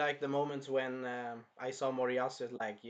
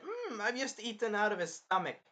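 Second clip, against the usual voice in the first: put-on higher-pitched quoted girl; imitated girl character; 6 semitones higher than usual. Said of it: "And she said".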